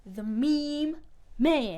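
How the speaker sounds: MEME and MAN punched loud and high; big, separated name call on "Meme Man"; careful announcing. Shown as a demonstration. Carefully announces tongue-twister name.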